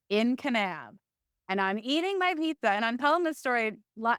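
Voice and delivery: ordinary talk, nothing marked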